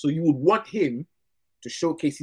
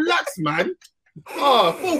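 high-pitched, laughing through words; laughing protest; 12 semitones higher, 4 dB louder than usual. Real, not acted. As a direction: crack up while telling him off.

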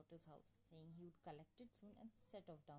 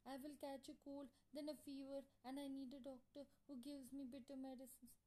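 high, whiny wail; hammed-up tearful complaining; 7 semitones higher, 4 dB louder than usual. A narrator's reading. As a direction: wail out each woe like a fussy bride. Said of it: "Now she started wailing even louder".

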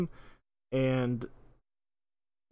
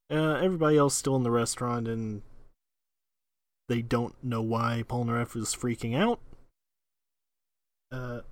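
ordinary talk

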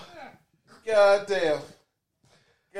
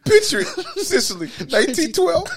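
put-on Golden Girls line squeezed out through laughter, higher; laughing too hard to get the quote out; 11 semitones higher than usual. Cracking up while quoting Golden Girls to his friend, laughing. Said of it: "Only Golden Girl fans will recognize that reference".

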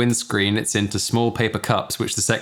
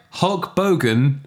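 ordinary talk

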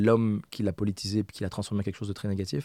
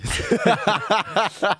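bright laugh; 10 semitones higher, 5 dB louder than usual. Real, not acted. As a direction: burst of laughter. Laughing at own summary.

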